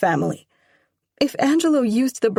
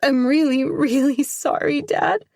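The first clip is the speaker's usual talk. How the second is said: higher and louder, crying through it; sobbing apology; 7 semitones higher, 5 dB louder than usual. Acted. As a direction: beg forgiveness through tears. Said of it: "Sobs rack my shoulders".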